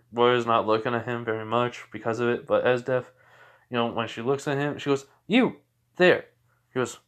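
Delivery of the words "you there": commanding call. Acted. Esdeath calling out to Izuku.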